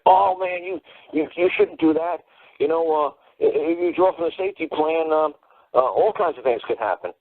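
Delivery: high-pitched, hammed-up warning lawyer voice; mock attorney. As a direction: squeak out the lawyer's nervous advice. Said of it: "And he's gonna say".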